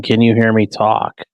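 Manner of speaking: slow, loud test voice. Real, not acted. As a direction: project it slowly. Mic test, speaking up.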